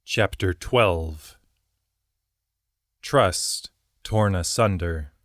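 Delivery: slow, deliberate title read; chapter title reading. A narrator's reading. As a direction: announce the chapter slowly.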